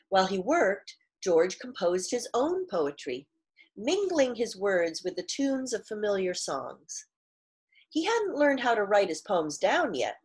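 clear, fuller read-aloud storytelling voice; story narration. A narrator's reading. Teacher reads picture book aloud.

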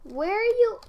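loud high call to the sims. Real, not acted. Gamer calling out to her sims, loud and high. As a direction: shout where are you into the house.

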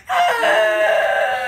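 high, loud sudden shriek; imitated scream. Sister recreating the scream heard from the bedroom, shrill shriek. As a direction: shriek out of nowhere. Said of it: "and then we just heard".

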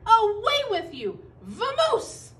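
loud, high banishing shout; outraged bear. A narrator's reading. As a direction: shout the intruder away at full force. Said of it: "rumbled Bear".

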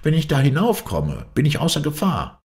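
high-pitched, delighted exclamation; joyful call. A narrator's reading. Climber cheers himself on at spotting a safe iceberg, joyful. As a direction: lift the voice high with joyful relief. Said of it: "rief er freudig".

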